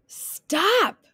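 high-pitched urgent yell. A narrator's reading. Wolf chasing Little Red on the ice, yelling. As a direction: yell it as one long call. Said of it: "yelled the wolf".

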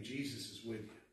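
low, soft retold comfort; gentle reassurance. Speaker recalling words that calmed the man, gentle reassurance. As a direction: repeat it softly, as a soothing word. Retelling his soothing words.

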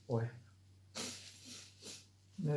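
muffled behind napkin; speaking while wiping mouth; through a napkin. Real, not acted. Seen 3: Napkin pressed over mouth; lowered 2.7-3.5, covers again 3.9-6.4.

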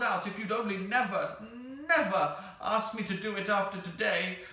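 high, loud, emotional wail; tearful creature. A narrator's reading. The Psammead begs to stop granting wishes, tearful. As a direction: pour it out like a creature near tears. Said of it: "it said in a tearful voice".

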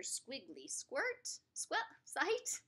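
ordinary delivery

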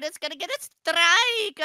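loud, high mock alarm; playful panic. Player dreading the bowling ball's strike, loud mock panic. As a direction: raise the voice as if about to be hit. Bowling ball about to hit.

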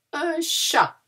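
"Un chat" lifted high, final T silent, "sha"; bright model word. Shown as a demonstration. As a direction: present "chat" brightly to children. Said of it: "We don't pronounce the T".

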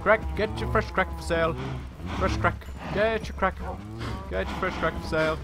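high-pitched market seller's cry; mock street hawker. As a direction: call out your wares like a street vendor. Plays street seller hawking wares.

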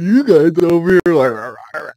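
loud, high mocking drunk voice; imitating the drunk man. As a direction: mimic the angry drunk customer. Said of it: "he said".

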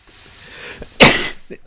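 loud real sneeze; one big sudden sneeze; sneezing. Real, not acted. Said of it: "I did it".

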